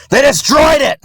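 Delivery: high, loud cry of outrage; anguished shout. Acted. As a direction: shout it in furious despair. Rages at the destruction.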